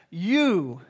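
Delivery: YOU said with weight, set apart; You stressed as the whole point; emphatic you. Shown as a demonstration. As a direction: lean hard on You. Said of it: "he's saying you emphatically".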